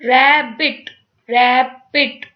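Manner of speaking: "रैबिट" broken in two, रै then बिट; loud two-beat "रैबिट" with a gap between syllables; split into syllables. Shown as a demonstration. Teacher showing two syllables, "रैबिट" split in two. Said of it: "इस तरह से".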